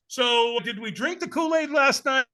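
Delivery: high, slow put-on questioning voice; mock wondering. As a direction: draw out a comic, high-pitched question. Mock question about the morning mood.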